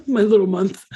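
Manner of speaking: laughing as she repeats herself. Real, not acted. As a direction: giggle it out again.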